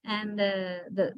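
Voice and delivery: ordinary talk, nothing marked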